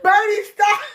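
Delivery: words collapsing into helpless laughter; laughing to tears. Real, not acted. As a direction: beg them to stop while cracking up. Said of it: "I'm laughing, crying, y'all".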